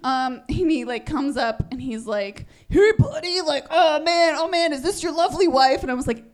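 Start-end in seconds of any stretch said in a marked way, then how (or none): 2.7-5.8 pushy salesman. Retold or imitated. Pushy resort guy greeting.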